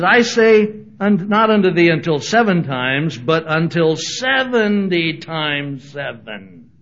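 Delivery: loud, slow, emphatic rebuke; Jesus blowing his fuses. Retold or imitated. Jesus answering Peter, loud rebuke. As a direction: boom it out like a fed-up teacher. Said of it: "Jesus blew his fuses".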